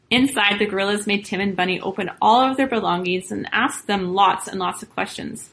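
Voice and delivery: usual speaking voice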